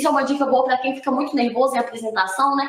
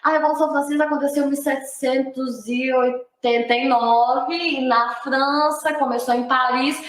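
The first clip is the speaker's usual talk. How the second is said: hesitant but calm. Shown as a demonstration. Teacher showing students that stumbling is fine, hesitant but calm. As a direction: drag out "oitenta e nove", searching for the date. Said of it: "é normal gaguejar".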